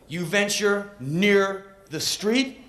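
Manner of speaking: usual speaking voice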